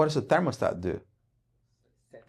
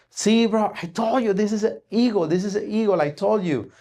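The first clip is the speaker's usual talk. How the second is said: super happy; 7 semitones higher than usual. Acted. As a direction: crow with delight that he was right. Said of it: "this guy gets super happy".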